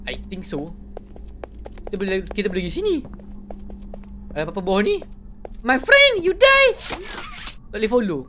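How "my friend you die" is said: high squeaky mock threat; comic high taunt, louder. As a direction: taunt the enemy in a silly high voice. Taunting a game enemy.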